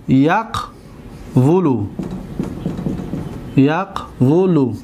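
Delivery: یقولو stretched as yaq-vu-lu, falling; slow model word. Shown as a demonstration. Teacher modeling the original form for the class. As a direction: draw out یقولو slowly. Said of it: "teacher drags the word out".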